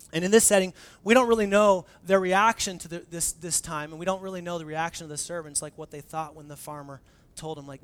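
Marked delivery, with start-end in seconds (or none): none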